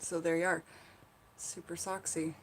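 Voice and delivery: quiet, low take in a muddled British accent. Shown as a demonstration. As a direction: try a soft British accent, almost whispered. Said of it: "it is funnier in a British accent".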